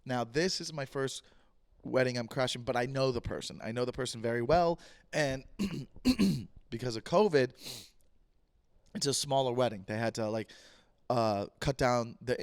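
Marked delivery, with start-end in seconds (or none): 5.5-6.5 quick rough clearing of the throat. Real, not acted. Podcaster clears his throat mid-story, a rough cough-like clear. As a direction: clear the throat before going on.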